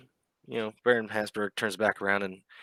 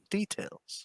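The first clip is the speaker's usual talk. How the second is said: hushed urging; 12 semitones higher, 6 dB quieter than usual. Acted. Baron urges for details.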